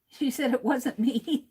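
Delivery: words breaking into a real laugh; amused laughing. Real, not acted. Host relaying Susan's denial to viewers, laughing. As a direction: say it through a chuckle.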